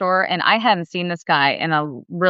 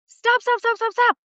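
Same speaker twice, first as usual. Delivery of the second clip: much higher, rapid pleading stops; urgent stop. Retold or imitated. Husband stopping his wife from punching, high urgent pleading. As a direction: hold her back fast.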